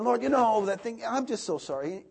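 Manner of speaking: high, loud mock-pleading prayer; hammed-up pleading. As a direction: overplay a grovelling apology. Mocking his own prayer-closet apologies.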